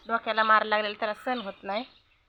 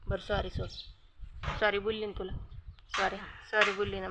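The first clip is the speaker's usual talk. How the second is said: soft apology; 7 dB quieter than usual. Real, not acted. Apologising softly to the pet.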